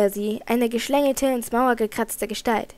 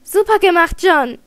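loud, higher cheer of relief; joyful call; 7 semitones higher, 11 dB louder than usual. Acted. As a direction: cheer her brother on with relief. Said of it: "rief Philippa". (lauter, erleichterter Jubelruf)

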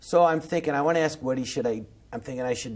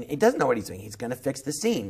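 ordinary delivery

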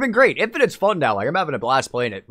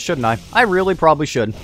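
ordinary talk

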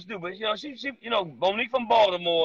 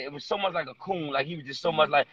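ordinary talk

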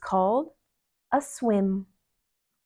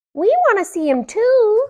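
high, loud tiny-bug voices; dragonflies; 13 semitones higher, 11 dB louder than usual. A narrator's reading. Two dragonflies butting in, high and loud. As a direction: chirp it like eager dragonflies. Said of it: "said two dragonflies".

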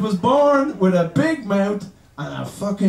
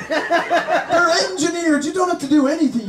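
ordinary delivery

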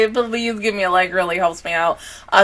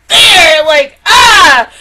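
loud tearful wail; crying shout; 6 semitones higher, 12 dB louder, much slower than usual. Real, not acted. Recalling Rengoku's death scene, loud and tearful. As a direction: let the grief break out loud.

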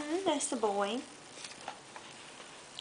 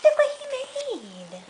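higher, slow and amazed; playful excitement; 6 semitones higher, slower than usual. Acted. Mother showing her toddler the mouse's drawing, excited. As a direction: sell the wonder to a toddler. Shows child the picture.

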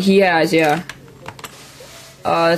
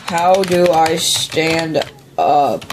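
very slow, word by word; slow, deliberate repeat; much slower than usual. Real, not acted. Stuck gamer asking how to stand up, slow and deliberate. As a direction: spell it out slowly, with a pause before the last word.

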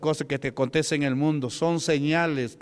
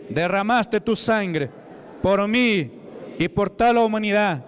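fervent prayer; 4 semitones higher, slower than usual. Real, not acted. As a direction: lead the people in fervent worship. Leads congregation in prayer. (oración fervorosa, lenta y fuerte)

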